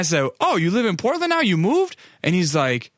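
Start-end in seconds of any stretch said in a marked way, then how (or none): 0.4-2.0 high-pitched retold surprise; high, incredulous acted-out questioning; surprised question. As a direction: replay being caught off guard by news.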